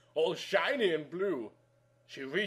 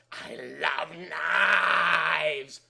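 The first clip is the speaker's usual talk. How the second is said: creepy deranged; much slower than usual. A narrator's reading. Drugged girl holding a knife, slow and deranged. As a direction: savour it like a madwoman.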